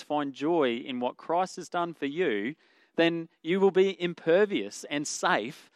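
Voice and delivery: normal talking voice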